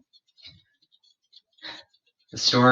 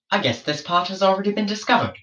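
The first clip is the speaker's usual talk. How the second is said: higher, quick, mildly put-on explorer voice; breezy higher aside, hurried along; George's musing voice; 7 semitones higher, much faster than usual. Englishman shrugging that the land is found, light high voice.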